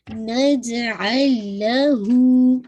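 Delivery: नजअल लहू slow, जीम bounced; qalqala on jeem. Shown as a demonstration. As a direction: recite नजअल लहू slowly. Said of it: "जीम पे आपका कलकला". (जीम पर क़लक़ला)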